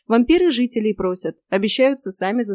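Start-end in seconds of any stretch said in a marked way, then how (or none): none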